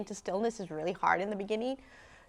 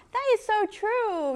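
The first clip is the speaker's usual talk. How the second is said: high, loud, drawn-out agreement; excited agreement; 13 semitones higher, 5 dB louder, slower than usual. Retold or imitated. Teacher enthusiastically agreeing with a student, excited. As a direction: gush it, stretching the words.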